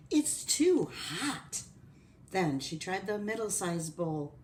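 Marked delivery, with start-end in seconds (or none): 0.1-1.6 high, drawn-out complaint; dismayed complaint. Acted. Goldilocks trying the biggest bowl, dismayed complaint. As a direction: object slowly, pitched up. Goldilocks reacts to hot porridge.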